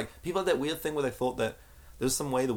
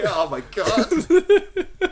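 drawn-out high laughing "oh my God"; laughing exasperation; 11 semitones higher, 10 dB louder, much slower than usual. Retold or imitated. At Simon's Overwatch mistake, laughing exasperation. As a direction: stretch it out, helpless laughter.

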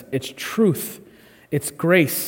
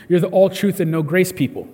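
normal talking voice

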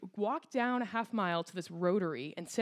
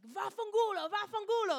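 much higher, squeaky mock Italian cursing; angry Italian boy; 13 semitones higher than usual. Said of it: "and he was like".

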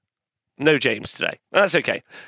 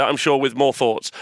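ordinary talk

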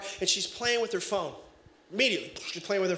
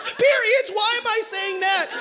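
much higher, louder squeak; shocked squeak; 12 semitones higher, 6 dB louder than usual. Retold or imitated. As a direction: yelp it in disbelief. Dad shocked at own words.

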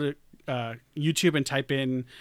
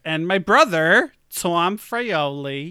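drawn-out Long Island name, ends sung; slow, sing the end of the name the Long Island way; Long Island accent; 3 semitones higher, 7 dB louder, slower than usual. Shown as a demonstration. A hint of Long Island to introduce her brother. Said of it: "You gotta say it like that when you're talking about Long Island".

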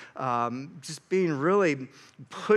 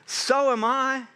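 defiant retort; 8 semitones higher, 4 dB louder, slower than usual. Retold or imitated. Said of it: "Paul's like".

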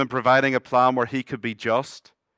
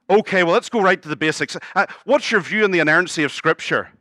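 higher and louder, brisk and bureaucratic; officious supervisor angel; 6 semitones higher, 5 dB louder than usual. Retold or imitated. Supervisor angel quizzing the thief on doctrine, higher and louder. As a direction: run the checklist like a fussy clerk.

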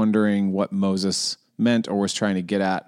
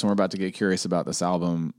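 usual speaking voice

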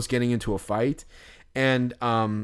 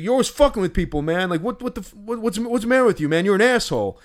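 angry rant; 8 semitones higher, faster than usual. Retold or imitated. Robbed guy yelling at the prankster, high angry retold rant. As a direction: scold him like you're fed up. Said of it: "he's like".

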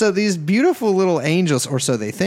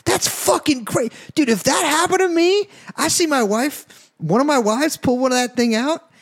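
excited; 9 semitones higher than usual. Real, not acted. Worked-up excited rant.

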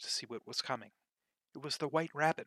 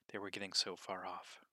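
hushed; 5 semitones lower, 6 dB quieter than usual. A narrator's reading. Narrator drops to a hush.

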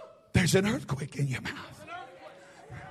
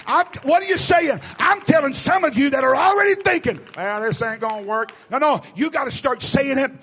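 higher, shouted revival preaching; full-force preaching; 7 semitones higher, 7 dB louder than usual. Real, not acted. Preacher urges the congregation to say it, shouting fervently. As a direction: drive the congregation to declare it, at a shout.

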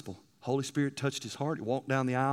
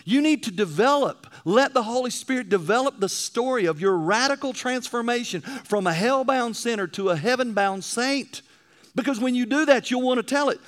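raised, ringing pulpit voice, pitch up; full-force preaching; 6 semitones higher, 5 dB louder than usual. Acted. Preacher urging the congregation to tell their story, full-force preaching. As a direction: drive it home to the pews like a revival preacher.

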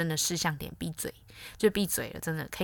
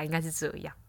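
ordinary talk, nothing marked

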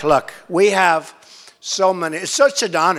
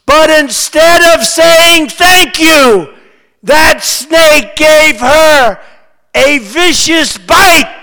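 loud, higher-pitched, slow rally recitation; dramatic poem recital; 7 semitones higher, 14 dB louder, slower than usual. Acted. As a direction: declaim the verse slowly to a big crowd, building louder and higher. Reads The Snake poem aloud.

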